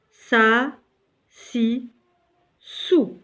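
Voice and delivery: sa, si, sou said clearly one by one; reading the syllables aloud. Shown as a demonstration. Teacher reviewing short vowels, syllable by syllable. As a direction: model each syllable for the class. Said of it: "Alors, je lis sou".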